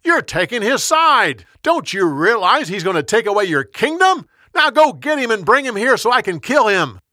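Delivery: heated high-pitched tirade; angry yell. A narrator's reading. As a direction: yell it in outrage, pitched well up. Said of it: "Saul yelled at his son".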